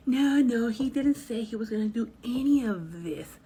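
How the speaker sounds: put-on loud, high protesting tipsy girl. Driver voices tipsy girl loudly denying it. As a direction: push back in denial, loud.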